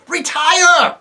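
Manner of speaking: very high, loud distant command; a commander calling retreat from far off; calling out from afar. Acted. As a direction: call the retreat across the field. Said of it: "calling out from the distance".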